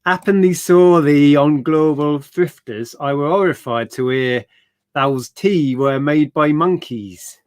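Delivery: slow put-on Yorkshire reading of the note; Yorkshire accent. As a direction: read it like a broad Yorkshireman, slowly. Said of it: "Worst Yorkshire accent ever".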